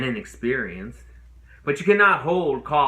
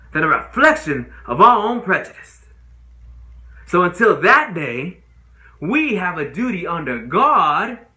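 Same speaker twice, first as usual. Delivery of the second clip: louder, higher, emphatic courtroom oratory; impassioned plea; 5 semitones higher, 7 dB louder than usual. Acted. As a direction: plead forcefully with the jury. Impassioned courtroom plea for equal justice.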